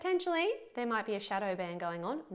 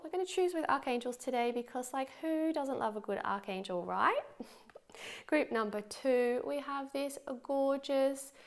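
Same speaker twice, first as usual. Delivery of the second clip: ordinary talk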